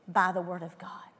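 breathy whispered close. Real, not acted. Preacher driving home scripture to the congregation, whispered. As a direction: whisper "by the word of God" intimately.

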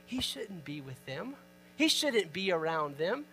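hushed, mildly judging imitation; disapproving attitude. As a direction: quietly act out their disapproval. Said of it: "they had this attitude of".

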